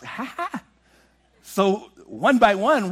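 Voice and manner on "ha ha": small high "ha ha"; soft mock laugh. Kids mocking the lady in the back. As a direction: quietly echo the lady. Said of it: "And she's".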